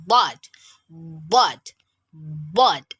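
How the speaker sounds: "but" with a ballooned-cheek onset, flattening after; cheeks bloating. Shown as a demonstration. Teacher repeating the sound for learners, cheeks bloating. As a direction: swell into each "but", then let go. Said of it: "it should be bloating just like balloon".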